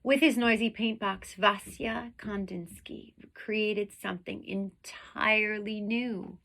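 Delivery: lively read-aloud storytelling voice; expressive children's story reading; story narration. A narrator's reading. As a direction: read it to the class like a picture book. Reads picture book aloud.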